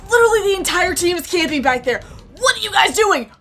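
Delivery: high, loud scolding yell; exasperated shouting. Real, not acted. Player to teammates camping back there, exasperated shouting. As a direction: shout at them, building to a command.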